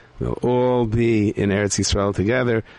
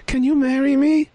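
high female character voice; slower than usual. Acted. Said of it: "She goes".

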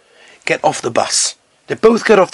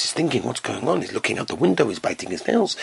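much softer, lower hushed telling; low, near-hushed suspense; hushed anxious narration; 7 semitones lower, 9 dB quieter, faster than usual. A narrator's reading. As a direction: draw listeners into his nerves quietly. Nervous man's anxious thoughts.

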